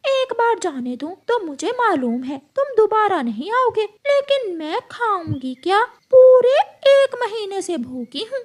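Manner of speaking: high shrieking hawk voice; hungry menacing hawk. Acted. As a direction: snarl at the prey out of hunger.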